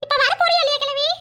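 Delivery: very high, impatient nasal voice; high nasal daughter-in-law nagging her elder; nasal demand. Acted. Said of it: "மூக்கால பேசுற மாதிரி".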